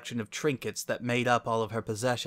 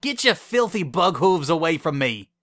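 loud, higher, disgusted yell; angry shout; 6 semitones higher, 7 dB louder than usual. A narrator's reading. Bon Bon pushing her double away, angry shout.

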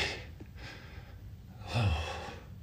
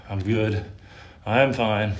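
normal talking voice

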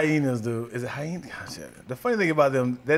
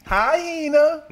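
high greeting voice; 12 semitones higher, 7 dB louder than usual. Pretend. Greeting in a put-on voice.

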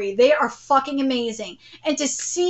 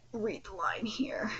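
whisper; 6 semitones lower, 17 dB quieter than usual. Real, not acted. Whispered aside.

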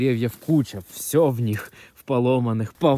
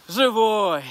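excited shout; 9 semitones higher than usual. Real, not acted. Dog checked, relieved cry.

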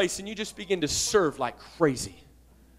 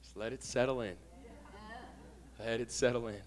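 much softer, lower and hushed; hushed pause; 10 semitones lower, 17 dB quieter than usual. Real, not acted. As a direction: let each word sink in softly. Pastor lets words sink in.

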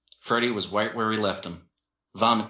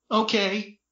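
high, small-voiced agreement; tearful; 13 semitones higher than usual. A narrator's reading. Brother still crying on the floor, tearful. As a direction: give in with a mild whimper. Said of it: "still crying on the floor".

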